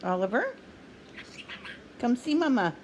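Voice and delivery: normal talking voice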